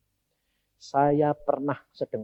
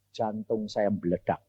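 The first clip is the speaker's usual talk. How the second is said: usual speaking voice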